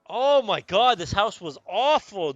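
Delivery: loud, high burst of disgust; exasperated outburst. Real, not acted. Telling friends about the awful rented house, loud and appalled. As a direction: blurt it out, appalled.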